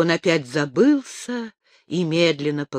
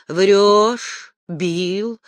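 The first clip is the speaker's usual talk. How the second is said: hoarse; 3 semitones higher, 5 dB louder than usual. A narrator's reading. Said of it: "хрипло, глухо твердила женщина".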